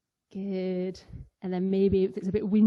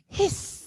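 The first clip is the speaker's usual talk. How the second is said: a much higher, breathy snake hiss; 11 semitones higher than usual. Pretend. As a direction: hiss sharply like a snake on three. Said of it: "we'll hiss".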